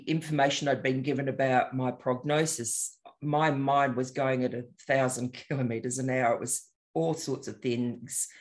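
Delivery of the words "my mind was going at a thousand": ordinary talk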